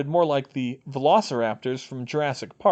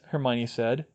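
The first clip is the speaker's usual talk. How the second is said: usual speaking voice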